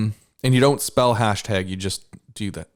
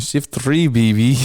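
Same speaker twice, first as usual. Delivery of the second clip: put-on French accent; 2 semitones higher, 5 dB louder than usual. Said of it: "like a French person".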